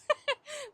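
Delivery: sudden high, loud laugh; burst of laughter. Real, not acted. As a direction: break into a squealing laugh. Laughing at glamorous nineties moms.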